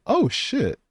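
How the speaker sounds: high startled exclamation; jolted by a surprise; real high startled gasp; surprised. Real, not acted. Sudden surprise at gift.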